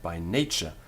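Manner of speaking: ordinary talk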